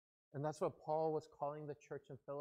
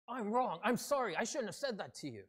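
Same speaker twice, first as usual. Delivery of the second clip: higher, louder apology that eases at the end; angry voice; 6 semitones higher, 5 dB louder than usual. Acted. Church member apologising to another, in an angry voice. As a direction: say sorry as if in an argument. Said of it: "Or even without the angry voice".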